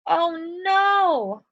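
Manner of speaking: high, scared little pig cry; cried out in fright. Acted. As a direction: squeak it out like a frightened piglet. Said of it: "cried the little pig".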